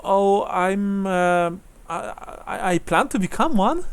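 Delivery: put-on high-pitched, hesitant young woman; Brunhilde. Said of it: "says Brunhilde".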